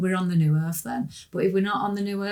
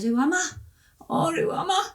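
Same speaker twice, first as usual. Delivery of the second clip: tearful; 11 semitones higher than usual. Retold or imitated. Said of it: "Started crying".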